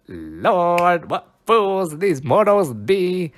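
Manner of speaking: grand declamation. Shown as a demonstration. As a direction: relish it like a stage Puck. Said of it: "one of my favorite lines so far to say".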